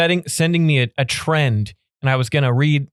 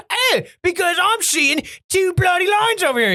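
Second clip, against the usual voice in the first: high, angry mock-British shout; hammed-up British outrage, pitched way up; sudden British accent. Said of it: "He's British all of a sudden".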